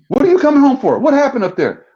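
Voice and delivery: high, loud, startled southern-free mom voice, a mock alarm. Mom shocked her son is coming home, high loud alarm. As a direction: imitate mom's alarmed high cry. Mother startled by son's call.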